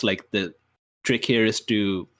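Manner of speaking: ordinary delivery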